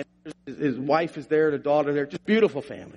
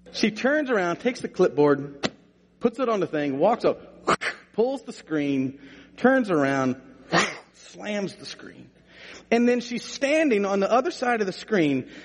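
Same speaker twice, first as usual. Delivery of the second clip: ordinary talk, nothing marked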